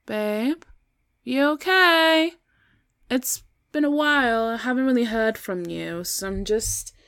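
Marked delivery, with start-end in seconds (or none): 0.1-6.0 raised, questioning call. Acted. Girlfriend checking on her sulking boyfriend, calling out. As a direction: call up to someone who won't answer.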